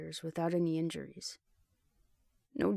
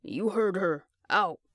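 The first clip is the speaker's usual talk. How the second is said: louder, scowling command; gruff order; 5 dB louder, slower than usual. A narrator's reading. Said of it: "scowled at the nearest guy".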